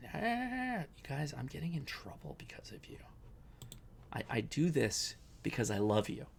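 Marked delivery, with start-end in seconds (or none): 0.1-3.0 whisper. Real, not acted. Whispering to viewers about wife.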